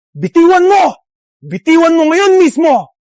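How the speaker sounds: angry command at full voice; angry demand. Acted. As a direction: order them to let go, furious.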